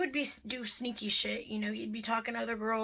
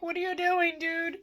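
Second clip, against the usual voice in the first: retold anger; 6 semitones higher than usual. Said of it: "I was so angry".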